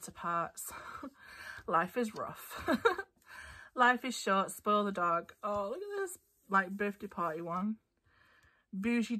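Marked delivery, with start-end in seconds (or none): none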